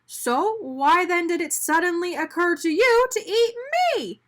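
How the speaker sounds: high, loud angry demand; indignant donut demanding. A narrator's reading. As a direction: press him hard for an answer. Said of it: "Arnie demanded".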